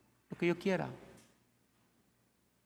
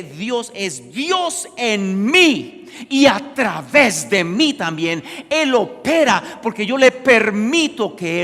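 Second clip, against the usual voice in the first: very loud, high climactic proclamation; impassioned shouted sermon climax; full-force preaching; 7 semitones higher, 14 dB louder than usual. Real, not acted. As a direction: thunder it to the congregation, stretching key words.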